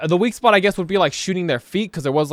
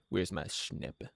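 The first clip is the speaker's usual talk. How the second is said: much lower and softer, slowed, a silly little murmur; playful low murmur; 11 semitones lower, 20 dB quieter than usual. Shown as a demonstration. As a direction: mutter it to yourself slowly, low and soft. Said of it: "Where's my dippy dapper?".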